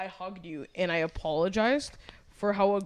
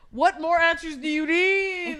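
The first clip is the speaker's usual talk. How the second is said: loud, slow, exasperated; retold frustrated shout; exasperated demand; 10 semitones higher, 8 dB louder, slower than usual. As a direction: hammer the question out in disbelief. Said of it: "I was like".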